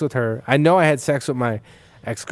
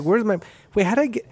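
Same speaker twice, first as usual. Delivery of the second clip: confused panic; 8 semitones higher than usual. Retold or imitated. Waking up confused, retold.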